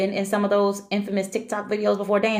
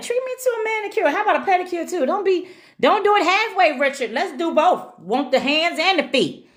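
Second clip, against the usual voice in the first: playful pleading; 11 semitones higher than usual. Real, not acted. Playfully begging viewer for treat.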